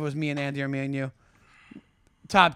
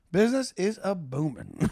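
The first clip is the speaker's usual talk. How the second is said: lightly put-on movie character voice; Brad Pitt. Retelling a favorite Nazi-killing line, lightly imitated. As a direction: quote it with a hint of impression. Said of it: "Brad Pitt rips it in that movie".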